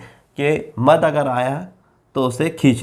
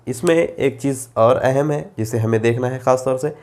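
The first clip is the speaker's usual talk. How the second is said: ordinary talk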